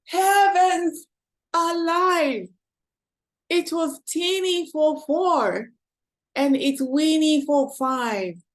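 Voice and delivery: put-on old lady crying out. A narrator's reading. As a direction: throw up her hands in dismay. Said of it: "The little old lady cried".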